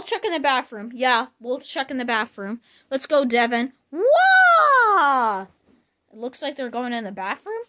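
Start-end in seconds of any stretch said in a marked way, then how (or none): 3.9-5.4 startled yelp. Acted. Scare in the bathroom.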